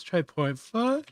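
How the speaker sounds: talking through a yawn; words stretched by a yawn. Real, not acted. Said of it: "I'm so sorry for yawning constantly".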